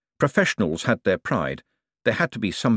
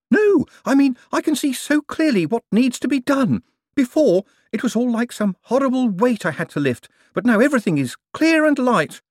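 much higher, louder, almost manic inventor; energised Clamp; 4 dB louder than usual. A narrator's reading. Clamp explaining his new clarity, feverish and giddy.

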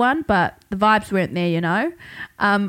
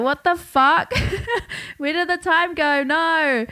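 higher, disbelieving what the fuck; mock alarm at the dawn; pitched-up startled disbelief; retold shock; 7 semitones higher than usual.

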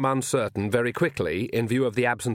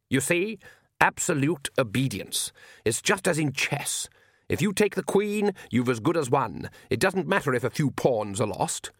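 measured Count voice, leaping up on key phrases; smug vampire Count; 3 semitones higher than usual. Acted. Vampire Count explains his chess strategy to his son, smugly. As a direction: lecture like a self-satisfied vampire lord.

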